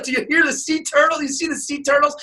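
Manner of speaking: high-pitched mock tourist excitement; excited snorkelers. Snorkelers calling to each other about turtles, mock-excited. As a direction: mimic thrilled tourists in a high voice.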